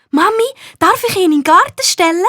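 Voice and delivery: put-on high, quick child's voice pleading to Mami; a small girl begging sweetly; eager little girl asking. A narrator's reading. Nina asks her mum to put the gnome outside, high eager child. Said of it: "fragt Nina".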